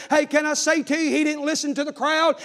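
ordinary delivery

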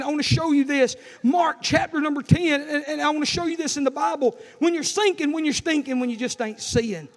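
loud, insistent call to the scripture; forceful preaching. Real, not acted. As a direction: preach it out with driving energy. Urges congregation to the Bible.

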